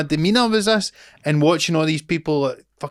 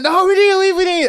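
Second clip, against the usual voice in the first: put-on panicked child; 7 dB louder than usual. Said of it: "And I'm like".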